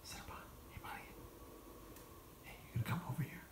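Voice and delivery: soft whispered coaxing; whispering. Real, not acted. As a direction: whisper to coax the cat over. Whispered call to cat.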